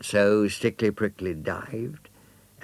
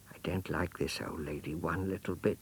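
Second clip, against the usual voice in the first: soft, hushed grumble from hiding; whispered aside; 7 dB quieter than usual. Acted. Hedgehog hiding, overhearing.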